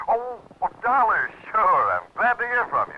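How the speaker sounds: flustered surprise, slowing on "Dollar"; startled. Acted. Lieutenant startled by Dollar's call.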